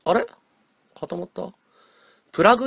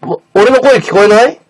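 raised voice; 6 semitones higher, 9 dB louder than usual. Real, not acted. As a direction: raise your voice into the phone. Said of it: "すごくちっちゃいけど".

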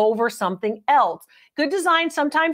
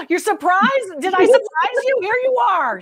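higher and louder, playful delight; excited greeting; 7 semitones higher, 3 dB louder than usual. Real, not acted. Host cheerfully greets newly arrived guests, higher and louder. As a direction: greet them like a surprise party.

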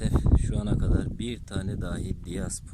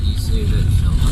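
on a bumpy road; 12 semitones higher, 14 dB louder than usual. Real, not acted. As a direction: voice jolted by a rough drive. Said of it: "yol çok bozuk".

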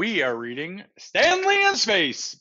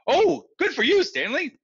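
higher, louder delighted praise; 13 semitones higher, 4 dB louder than usual. Acted. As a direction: cheer the boy on. Praise for Stanley.

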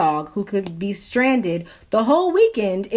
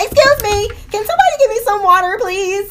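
loud, high-pitched call for help; desperate plea; 12 semitones higher, 7 dB louder than usual. Retold or imitated. Sick customer calling to the counter for water, desperate plea. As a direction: call out urgently, much higher and louder.

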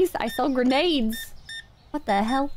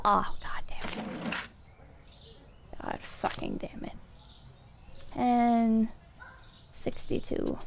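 whisper; 6 semitones lower, 8 dB quieter than usual. Real, not acted. Cashier game muttering to self.

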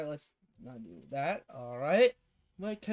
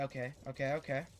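ordinary delivery

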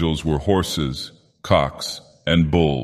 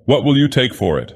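lightly voiced artist, pitched higher; eager artist; 6 semitones higher than usual. A narrator's reading. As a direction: ask the price in a raised, higher voice. Artist bargains for the nose.

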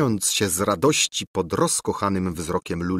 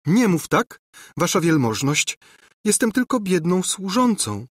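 higher, lightly voiced maid; humble girl's voice, not truly whispered; delighted servant girl; 9 semitones higher than usual. A narrator's reading. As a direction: protest modestly while secretly delighted. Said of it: "szeptała Ruzia, do głębi uradowana".

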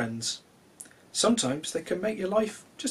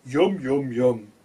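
yummy relish; 3 semitones lower, 3 dB louder, much slower than usual. Acted. Character relishing honey.

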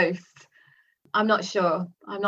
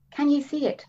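Kitty cooing; 8 semitones higher than usual. A narrator's reading. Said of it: "Kitty cooed, a faint tremor of excitement".

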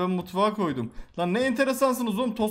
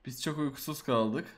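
ordinary talk, nothing marked

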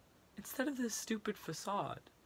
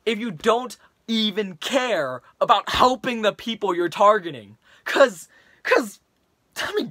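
put-on frustrated teen rant, building louder; Leon, angry rant; 4 dB louder than usual. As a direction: vent at a classmate, getting heated. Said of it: "in the following rant to his classmate".

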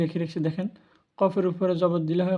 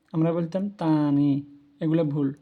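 usual speaking voice

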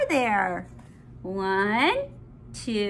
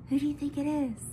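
ordinary delivery

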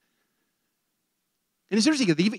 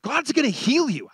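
raised, emphatic promise to someone; higher, firm pronouncement; preached declaration; 7 semitones higher than usual. Retold or imitated. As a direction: declare it out to the room. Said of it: "as I was preaching the message, I said".

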